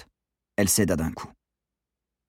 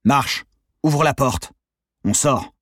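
raised, urgent commands; forceful orders; 6 semitones higher, 4 dB louder, slower than usual. A narrator's reading. Gunman to hostage at door, forceful orders.

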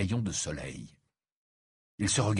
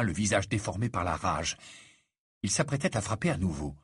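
ordinary delivery

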